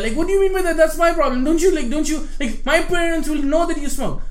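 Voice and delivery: high, loud mock outrage at the roommate; mock angry rant. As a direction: replay the fight, ranting high and loud. Angry retold quarrel with roommate.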